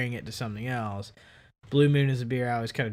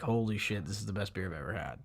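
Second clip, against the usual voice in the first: hushed awe; 5 semitones lower, 9 dB quieter than usual. Retold or imitated. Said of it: "me just being like".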